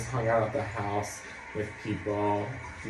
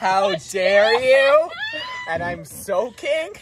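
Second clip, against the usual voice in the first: high, slow playful indignation; mock outrage; 13 semitones higher, 7 dB louder, slower than usual. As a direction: play at being offended. Pushed in pool, mock outrage.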